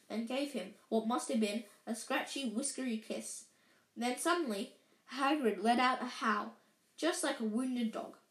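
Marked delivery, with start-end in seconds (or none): none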